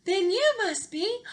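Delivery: amazed Cinderella. Acted.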